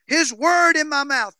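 fervent slow sermon climax; slow booming preaching. Acted. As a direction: lean into each word for the crowd.

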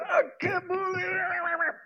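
high crying shout; anguished cry of disbelief; upset outcry. Pretend. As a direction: wail it in disbelief. Upset after wrong turn.

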